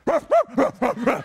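high puppy barks; sharp burst of mock barking; imitated dog barking. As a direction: bark back like a defiant puppy. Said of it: "She goes".

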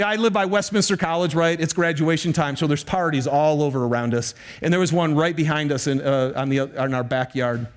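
ordinary delivery

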